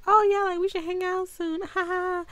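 high, bright-eyed fake friendliness, ending in a giggle; mock sweet cheer with a laugh; fake cheery. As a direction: sing it up high like a nervous flirt. Flirty fake cheer with guard.

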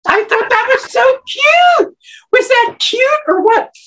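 high, loud gushing delight; thrilled squeal. Real, not acted. Fan recalling a sweet moment, gushing in high excited delight. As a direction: squeal it like the sweetest thing ever.

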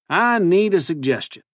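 high-pitched folksy cowboy twang; cowboy accent. A narrator's reading. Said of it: "his ridiculous new accent".

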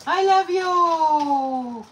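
very high, very slow loving coo; drawn-out high coo. Real, not acted. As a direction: stretch it out high like doting on a pet. Cooing love to the dog.

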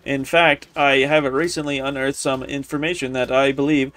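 low, formal letter-reading voice. Acted. Admirer writing to Master Volo, low formal reading. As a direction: read it as a scholarly invitation. Reads an in-game letter aloud.

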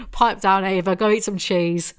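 loud, exasperated put-down; loud heckle. Acted. Reader tells dramatic Ava to pipe down, loud heckle. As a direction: shut her up with a loud dismissal.

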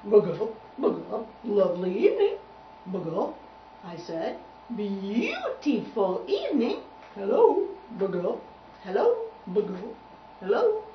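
croaking, loud frog voice; bullfrog greeting. Acted. Bullfrog greeting silent Tubby, hearty croaky voice. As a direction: punctuate greetings with croaked syllables.

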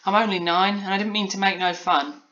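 put-on high apologetic child; Polly embarrassed. A narrator's reading. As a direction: explain yourself like a sheepish little girl. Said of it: "replied Polly in embarrassment".

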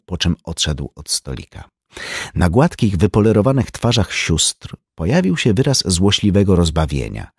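ordinary delivery

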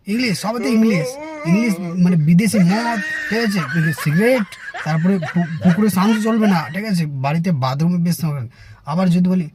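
ordinary talk, nothing marked

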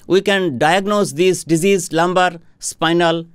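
ordinary delivery

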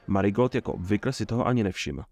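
ordinary delivery

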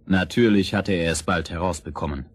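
ordinary delivery, nothing marked

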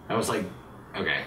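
ordinary talk

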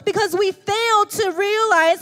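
high, loud, urgent sermon voice; full-force preaching. Acted. Pastor urging believers to drop grave clothes, preaching hard. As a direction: drive the point home to the pews.

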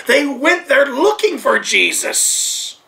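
ordinary delivery

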